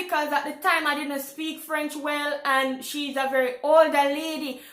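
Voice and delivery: ordinary talk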